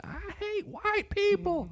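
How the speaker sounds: high, slow mock shriek of a street lady; mimicking screaming lady. Retelling friends the lady's mock high rant. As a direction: ham up the ranting homeless woman. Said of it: "homeless lady just screaming the whole time".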